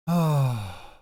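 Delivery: loud, drawn-out sigh; weary sigh. Real, not acted. Co-host tired from early mornings, weary sigh. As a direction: let out a big tired sigh. Tired speaker sighs before complaining.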